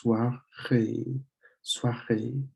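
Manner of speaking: "soirée" slowly, drawing out the final é; lengthened final E. Shown as a demonstration. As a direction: model "soirée" with a long closing é sound. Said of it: "lengthens the sound of the E".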